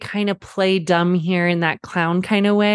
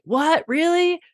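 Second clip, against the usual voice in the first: a much higher, rising "What? Really?"; mock wide-eyed surprise; 10 semitones higher, slower than usual. Dad to his kids, hamming up surprise. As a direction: play up the amazement. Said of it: "wide-eyed".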